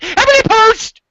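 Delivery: high prim voice, falling at the end; prim sign-off. Pretend. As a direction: chirp the name like a proper lady.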